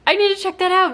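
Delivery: high-pitched, eager exclamation; excited self-quote. Retold or imitated. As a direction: act out a sudden excited must-see reaction. Acting out own excited reaction.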